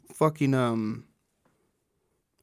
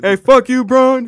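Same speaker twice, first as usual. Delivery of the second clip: much higher and louder jeering yell; mock heckler yell; 9 semitones higher, 11 dB louder than usual. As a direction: yell at him like a courtside heckler. Imitating a heckling fan.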